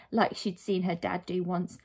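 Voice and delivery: ordinary delivery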